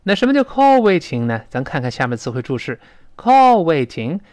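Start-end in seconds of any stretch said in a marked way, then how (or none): 3.2-4.2 slow loud model reading. Shown as a demonstration. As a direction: Call waiting spelled out slowly and loudly. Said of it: "读慢了呢，是这么读的".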